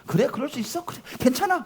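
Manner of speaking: soft, high, gently forgiving; hushed kind inner voice; gentle self-reassurance. Acted. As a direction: softly tell yourself it's fine. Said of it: "나를 속상하게 하는 사람 봐도 좋은 거예요".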